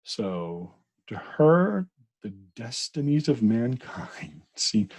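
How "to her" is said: "to her" pitched up high and much louder; stress on to her. Acted. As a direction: punch "to her" hard and high. Re-reads phrase with emphasis.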